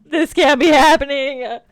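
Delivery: loud, aghast protest at the game; loud disbelief. Real, not acted. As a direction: shout it out in disbelief. Disaster in the game.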